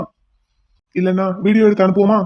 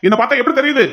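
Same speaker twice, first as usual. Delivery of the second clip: raised, pitched-up demand; angry shout; 6 semitones higher, faster than usual. Acted. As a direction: yell it at her. Repeats question, shouting. (உயர்ந்த குரலில் கோபக் கத்தல்)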